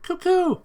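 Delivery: high bird call; shrill cuckoo bird cry; pigeon cry. Acted. Pigeon Oko-san cries out.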